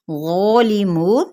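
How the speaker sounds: ग़ालिमून with the heavy ग़ and a two-count long vowel; slow Quranic recitation; two-count stretch. Shown as a demonstration. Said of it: "दो हरकत के बराबर खींचेंगे".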